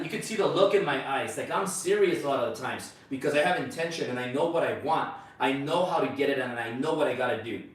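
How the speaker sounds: ordinary talk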